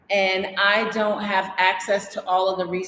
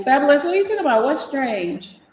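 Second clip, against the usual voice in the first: quick, high, baffled outburst; surprised; 7 semitones higher, 4 dB louder, faster than usual. Real, not acted. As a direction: blurt it out in disbelief. Baffled reply to viewer.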